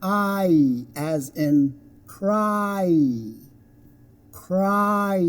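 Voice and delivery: slow, stretched "I" and "cry"; gliding diphthong. Shown as a demonstration. Teacher gives an example word, "cry" slowly stretched. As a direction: draw out the vowel glide in "I" and "cry". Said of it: "It kind of glides".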